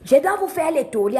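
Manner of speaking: ordinary delivery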